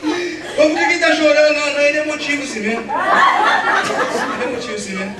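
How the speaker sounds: loud, high whiny protest through laughter; mock crying refusal. Preacher plays himself as a boy refusing fruit, loud whiny protest. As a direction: wail it like a sulking boy.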